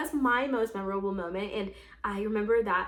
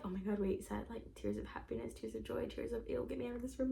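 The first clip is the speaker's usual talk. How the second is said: much softer, almost whispered worry; hushed puzzled quote; 13 dB quieter than usual. Retold or imitated. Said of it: "they were like".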